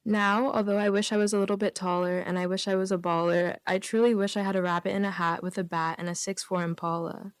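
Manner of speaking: ordinary talk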